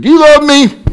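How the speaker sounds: high, loud wife pleading; wife asking her husband in the car; wife's voice. Retold or imitated. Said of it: "He said".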